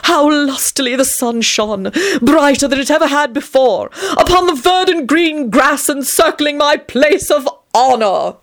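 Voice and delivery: high, slow, preening aristocratic voice; milk every boast like a vain lord; hammed-up self-adoring raconteur; vain vampire storyteller. A narrator's reading. Tony's boastful childhood tale.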